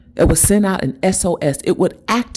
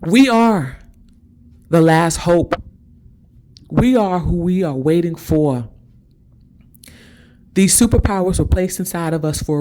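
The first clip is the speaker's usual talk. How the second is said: emotional, emphatic declaration in separate phrases; moved, deliberate proclamation; getting emotional. Real, not acted. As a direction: declare it with rising feeling, pausing between phrases. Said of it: "And I'm getting emotional".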